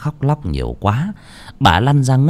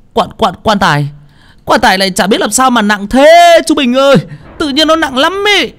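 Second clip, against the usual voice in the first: high, straining protest; stammer, then climb into a loud plaintive whine; panicked stammer; 4 dB louder than usual. Acted. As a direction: protest helplessly, voice pitched way up. Panicked bearer explains the too-heavy coffin.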